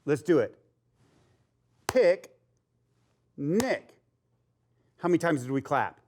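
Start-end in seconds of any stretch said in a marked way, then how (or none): 1.9-4.0 "Pick, nick" as two separate beats; clapped syllables. Shown as a demonstration. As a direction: split into two beats with a pause. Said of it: "we're gonna clap it".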